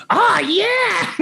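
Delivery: high, squealed mock reply; excited reply. Comedian answering his wife, excited high squeal. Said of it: "I'll be like".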